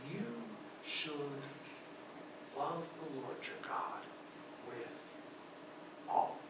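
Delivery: slow, spaced-out whisper; hushed, reverent whisper of the command; whispered quote. Shown as a demonstration. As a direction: breathe each word with long pauses. Jesus's words, slowly whispered.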